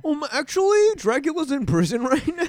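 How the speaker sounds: very high, loud nitpicker voice; mock pedant. Co-host mimics host's Dracula objection, high mocking pedant voice. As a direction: parody a know-it-all, breaking into a laugh.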